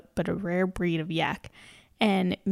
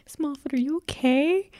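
put-on worried girl voice; 8 semitones higher than usual. Michi voiced in a retelling.

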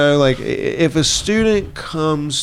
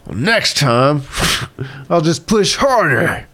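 own stubborn mindset; 5 semitones higher, slower than usual. Retold or imitated. Quotes own old stubborn mindset.

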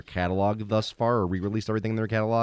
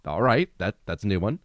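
usual speaking voice

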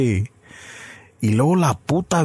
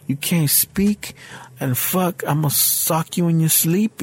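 imitating her freestyle; 5 semitones higher, slower than usual. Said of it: "she started freestyling me".

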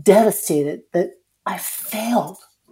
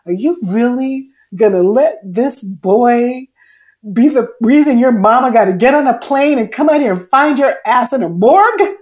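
scolding mother voice; 4 semitones higher, 7 dB louder than usual. Retold or imitated. Inner voice like her mother scolding her, loud and high. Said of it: "That's exactly what the voice was saying".

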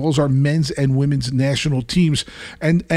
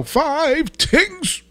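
high, drawn-out Caribbean-style "Five tings"; jingle accent; 11 semitones higher than usual. Acted. Segment title in a put-on accent.

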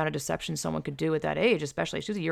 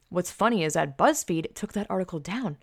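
ordinary talk, nothing marked

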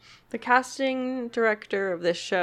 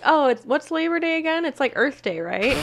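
clueless question; 7 semitones higher, 5 dB louder than usual. Retold or imitated. Retelling Cody's dumb question.

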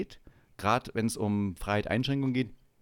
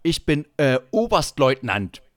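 imitating stern officer; 3 semitones higher, 8 dB louder than usual. Officer asserting his rank.

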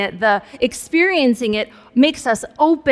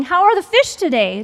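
high-pitched call out to boats; childhood shout; 7 semitones higher, 4 dB louder than usual. Retold or imitated. Child on the dock to boats going by, shouting. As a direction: re-enact a kid hollering from the dock.

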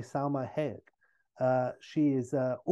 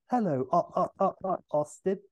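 lightly voiced glitching robot, stuttering higher; vaguely metallic robot; 5 semitones higher than usual. Robot Vanessa head greeting Austin, light mock metallic stutter. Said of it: "says in a vaguely metallic voice".

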